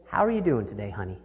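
a kind stranger reaching out quietly; gentle toll lady. Retold or imitated. Toll booth lady greeting frantic driver, soft tender voice. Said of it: "And she said".

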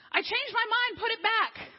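high, loud, frantic plea to stop; high frantic plea with a laugh; panicked plea. Retold or imitated. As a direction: beg to undo it all, half laughing. Blogger's panicked thought mid-birth.

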